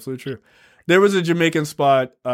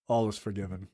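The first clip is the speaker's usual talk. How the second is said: softer, grand mock pardon; mock-solemn forgiveness; 6 semitones lower, 12 dB quieter than usual. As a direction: pardon them softly like a king. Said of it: "I was like".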